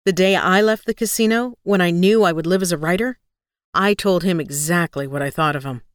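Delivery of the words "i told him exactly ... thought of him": usual speaking voice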